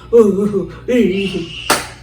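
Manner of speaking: drawn-out imitated sobbing wail; crying wail. Woman retelling her struggle in the trance, crying wail. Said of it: "That's I'm crying, I'm struggling".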